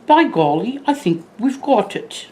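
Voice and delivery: a hint of a proper British butler; butler's British accent. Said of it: "with a little bit of the accent".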